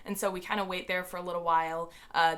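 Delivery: usual speaking voice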